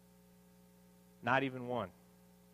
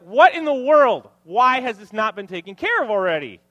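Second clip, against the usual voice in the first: hammed-up late-night rage at the wife; mock angry outburst; 4 semitones higher, 13 dB louder than usual. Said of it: "I was so mad".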